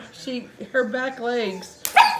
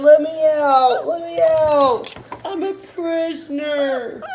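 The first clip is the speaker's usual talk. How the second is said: louder, slow plaintive dog voice; voicing the dog; 3 semitones higher, 8 dB louder, slower than usual. Owner voicing the shut-in dog begging, slow and loud. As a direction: whine it like a locked-up pup begging. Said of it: "He said".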